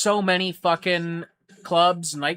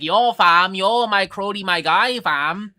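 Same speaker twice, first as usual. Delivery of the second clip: louder, higher mock Toronto slang; 5 semitones higher, 8 dB louder, slower than usual. Mocking kids on TikTok, loud higher Toronto slang. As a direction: ham up the TikTok kid slang. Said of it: "kids on TikTok or whatever being like".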